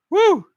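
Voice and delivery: high, loud whoop of release; loud woo. Real, not acted. Streamer recovering from getting emotional, high whoop. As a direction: let out a whoop to shake off emotion.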